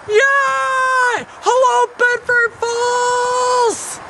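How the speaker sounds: high, slow, drawn-out hollering; overjoyed shouting. Acted. George is back in Bedford Falls, overjoyed shouting. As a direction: greet the whole town at a joyful yell. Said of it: "back in Bedford Falls".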